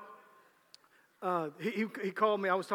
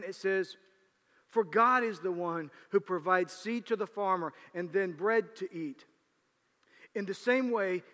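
scripture reading; 3 semitones higher, 5 dB louder than usual. Acted. Reads scripture.

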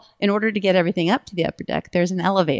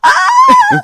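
very high, piercing, long scream; mock terrified scream; 13 dB louder than usual. Startled colleague opening elevator on hidden prankster, full mock scream. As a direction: shriek like someone ambushed. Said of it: "And she goes".

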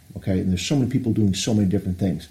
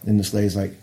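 ordinary delivery

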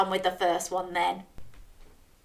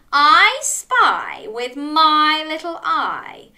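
slow, high, loud announcement; game-host flourish; 6 semitones higher, 6 dB louder, much slower than usual. Pretend. Storyteller starts the next riddle, dramatic build-up. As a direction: draw out the riddle opening theatrically.

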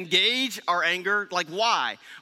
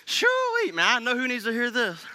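mock smug listener; 5 semitones higher than usual. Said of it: "the subject and go".

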